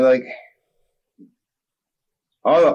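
usual speaking voice

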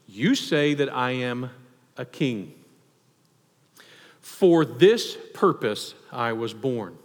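low and slow, weighty; solemn, slow declaration. Acted. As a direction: lay each word down with gravity. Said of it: "Jesus answered".

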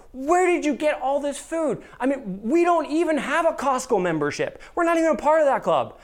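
high, loud, disbelieving questions; astonished mother-in-law. Retold or imitated. Mother-in-law at the door, amazed by the carts of food. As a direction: blurt it out, floored by the food. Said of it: "your mother-in-law goes".

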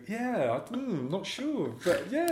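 high, slow, vague hedging; mock unsure. Actor fobbing off a fan with a vague hedge. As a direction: dodge the question with a vague high hmm. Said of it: "And I'd go".

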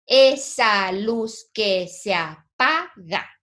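slow scansion, each syllable set apart. Shown as a demonstration. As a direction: count out "Esa luz que se apaga" syllable by syllable, running "se a" together. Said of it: "Juntamos en una sola sílaba". (sílaba por sílaba, despacio)

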